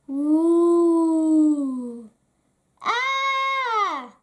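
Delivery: hammed-up spooky moan; mock ghost wail. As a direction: play the ghost to scare him. Bertie makes ghost noises.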